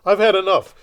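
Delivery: fed-up shout. Retold or imitated. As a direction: blurt it out like you're walking out. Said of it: "stand up and go".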